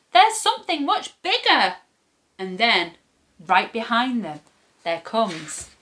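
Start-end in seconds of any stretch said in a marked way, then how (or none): 0.1-1.8 put-on high-pitched storybook children; children crying out. A narrator's reading. Children distract the hungry troll, high cry. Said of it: "cry the children".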